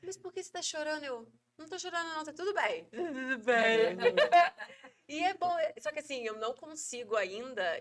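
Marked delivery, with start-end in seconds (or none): 0.0-1.0 voz de criança. Retold or imitated. Filha pergunta preocupada. 1.6-4.0 disfarce choroso. Retold or imitated.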